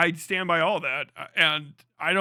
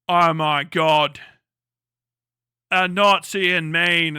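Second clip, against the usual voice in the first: slow, louder fake alarm, hammed up; mock panic; 6 dB louder, slower than usual. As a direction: gasp it out as a sarcastic impression of outrage. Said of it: "And they're like".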